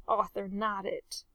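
ordinary delivery

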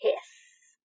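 long, breathy snake hiss; theatrical snake hiss. Acted. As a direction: hiss like a showy serpent. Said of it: "let out one admittedly theatrical".

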